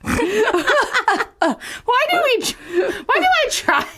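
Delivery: high-pitched fake wail; mock despair. Host giving up on the French joke, mock despair. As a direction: playfully cry out in hammed-up despair.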